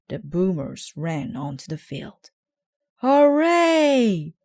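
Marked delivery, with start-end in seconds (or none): 3.0-4.4 much higher and much louder hooray; crowd roar. A narrator's reading. Fans roaring for the Boomers, a huge loud hooray. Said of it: "shouted the fans".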